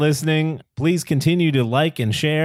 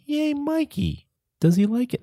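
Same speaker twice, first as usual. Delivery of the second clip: put-on high kid voice; 12 semitones higher than usual. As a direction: chirp it like an eager child. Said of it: "Every week I say".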